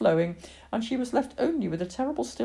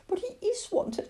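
put-on high, anguished child's protest; crying out. Lily cries out to save the unwanted cat, high anguish. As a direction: cry out in desperate contradiction. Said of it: "cried Lily".

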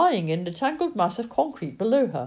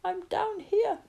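cracked shout; slower than usual. Acted. Trapped man cries up to rescuers, high cracked shout. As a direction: call up from under the rubble. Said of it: "He shouted in a cracked voice".

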